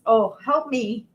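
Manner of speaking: high, playful wail for help; mock plea for help. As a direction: ham up the helpless cry. Jokingly pleading over stuck bottle.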